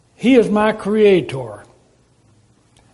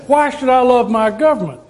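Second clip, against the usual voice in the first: higher, louder mock-indignant why, with a laugh; skeptical citizen; 6 semitones higher, 5 dB louder than usual. Preacher voicing a citizen questioning the government, mock-indignant. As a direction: toss the question back like a doubter would.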